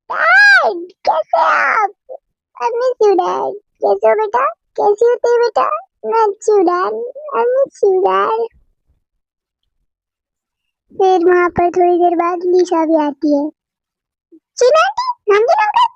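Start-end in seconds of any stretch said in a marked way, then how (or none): 0.1-8.5 higher, excited daughter voice; greeting her father, higher and excited. Acted. 14.6-15.9 much higher, squealy hello; excited greeting. Acted. Lisa greets aunt and uncle.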